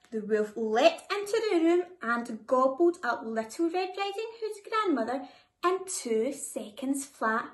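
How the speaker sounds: plain reading voice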